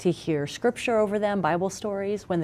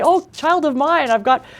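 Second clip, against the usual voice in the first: mock grand parent; 9 semitones higher, 8 dB louder than usual.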